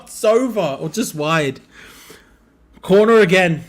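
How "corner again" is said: loud, high call; excited shout. Real, not acted. Fan shouting at the match as another corner comes, loud.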